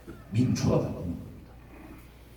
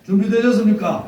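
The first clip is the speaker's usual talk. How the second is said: loud, high call to the crowd; rallying shout; 9 semitones higher, 8 dB louder than usual. Real, not acted. Rally leader repeats call louder. (군중을 향해 외치는 구호)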